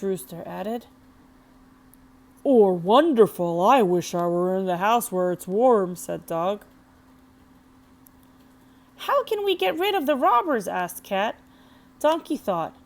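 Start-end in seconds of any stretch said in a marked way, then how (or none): 2.4-6.0 longing dog. A narrator's reading. Dog longs for the warm house. 9.1-10.7 worried cat. A narrator's reading. Cat asks anxiously.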